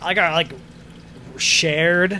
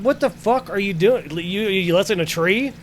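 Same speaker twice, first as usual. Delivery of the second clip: retold exasperated yell; 5 semitones higher than usual. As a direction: play the outraged coworker, building up. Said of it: "I was like".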